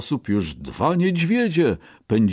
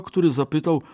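ordinary delivery

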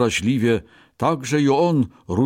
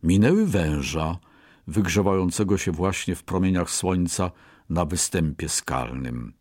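ordinary delivery

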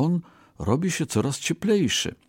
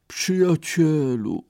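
much higher, slow weary moan; 8 semitones higher, slower than usual. A narrator's reading. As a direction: groan it out like an exhausted beggar. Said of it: "jęknął".

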